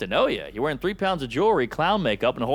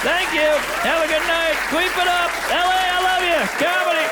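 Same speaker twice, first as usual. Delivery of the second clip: high shouted farewells over the crowd; hyped sign-off; 10 semitones higher, 4 dB louder than usual. Acted. Comic bids the crowd good night, hyped shouting. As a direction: holler each short phrase high, like closing a big show.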